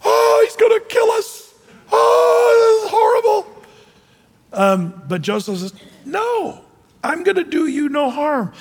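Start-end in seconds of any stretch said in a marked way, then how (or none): 0.0-3.6 panicked shout, much higher and louder; hammed-up frightened brothers shouting; freaked out. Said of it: "they all freaked out". 6.1-8.5 reassuring. Retold or imitated. Joseph reassuring his brothers.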